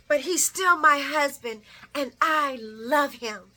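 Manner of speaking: a hammed-up plea for her husband; pleading wife. Nina pleading that she loves her husband. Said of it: "she's like".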